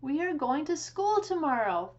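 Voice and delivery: high, loud eager call to the chickens; excited announcement. A narrator's reading. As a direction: share the big news brightly. Farmer Brown tells the chickens.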